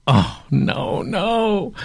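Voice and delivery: mock-dismayed drawn-out cry. Entrepreneur recalls facing a hard choice, mock dismay. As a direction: act out dreading the decision, pitch climbing.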